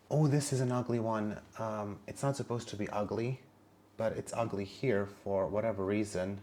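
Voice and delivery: ordinary delivery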